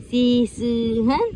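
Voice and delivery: usual speaking voice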